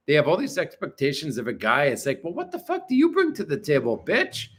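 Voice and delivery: ordinary talk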